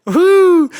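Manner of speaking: high loud whoop of relief; relieved whoop. Acted. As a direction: let the tension burst out. Relieved exclamation.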